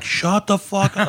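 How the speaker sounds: snapping it out, high and slow; retold blow-up. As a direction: bite his head off over lunch. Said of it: "I just blew up on him".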